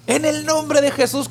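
impassioned command, pitched high without shouting. Acted. Passenger commands the wind in Jesus' name, with mounting passion. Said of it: "con más pasión".

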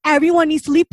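urgent warning. Retold or imitated. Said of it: "They're like".

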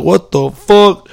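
high, slow, loud disbelief; shocked. Retold or imitated. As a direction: stretch it out, totally stunned. Friends shocked he appears.

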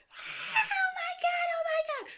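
high, loud squealed scream; retold scream. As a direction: reenact a scream of overjoyed shock. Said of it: "the scream that I scrumped".

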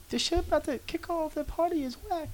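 squeaky high falsetto mock quote. Joking about the game intro song in a mock high voice. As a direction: pitch way up to mock the hype. Mocking quote of his own reaction.

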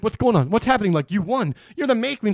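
loud, high bewildered rush; excited. Retold or imitated. Danny confused, others excited.

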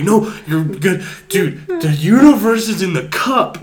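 high, loud, slow stoned revelation; hammed-up tripping urgency; acted-out excited insistence. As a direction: shout it like a cosmic discovery. Tripping, pleading with departing friend.

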